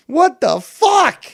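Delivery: slow, high, very loud mock-horrified yell; shocked shout. Streamer reacting to that thumb, shocked shout.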